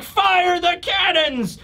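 high, drawn-out battle cry; Bakori yelling. Retold or imitated. Villain ordering his fleet to open fire, yelling. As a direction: stretch it into a high strained yell. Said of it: "Bakori yelling and shouting".

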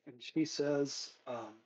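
ordinary delivery

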